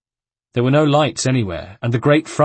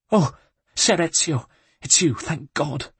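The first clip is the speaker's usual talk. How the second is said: relieved housekeeper; 6 semitones higher than usual. Acted. Housekeeper relieved to see him.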